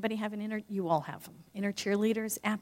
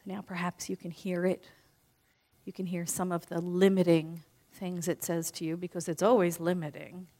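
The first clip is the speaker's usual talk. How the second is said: guided visualization; 2 semitones lower, 3 dB quieter than usual. Acted. Speaker guiding the audience through closing their eyes, softly.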